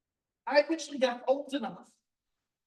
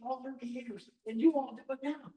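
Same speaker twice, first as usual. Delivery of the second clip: ordinary talk, nothing marked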